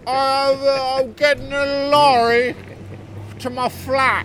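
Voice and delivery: exaggerated British accent parody. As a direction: lay on a put-on British accent like a pop star gone posh. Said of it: "fuck you, Madonna".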